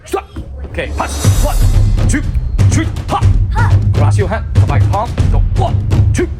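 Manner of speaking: loud drill commands shouted over music; martial arts instructor. Acted. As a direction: bark out the counts like a coach.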